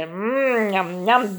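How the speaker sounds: soft yummy munching noises; munching sounds. A narrator's reading. Narrator playing Lutek stuffing himself at the inn, soft munching. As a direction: smack your lips like a happy eater.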